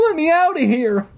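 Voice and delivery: high panicked scream to be let out. Acted. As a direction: yell for your life at the door. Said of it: "My scream ended in a choked sob".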